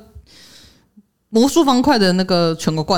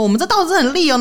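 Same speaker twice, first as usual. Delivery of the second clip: salesman pitch; 7 semitones higher than usual. Retold or imitated. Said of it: "就跟你卖刀子说".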